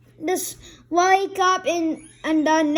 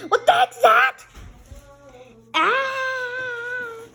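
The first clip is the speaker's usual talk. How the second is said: scared toy; 8 semitones higher, much slower than usual. Pretend. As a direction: shriek in fright at the monster.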